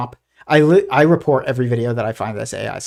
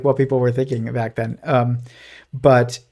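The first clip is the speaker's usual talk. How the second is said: usual speaking voice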